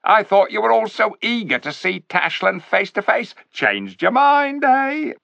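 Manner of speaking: high, loud sneering ape voice; sly ape. A narrator's reading. Ape sneering at the clever dwarfs, high and loud. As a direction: talk down to the dwarfs like a smug ape.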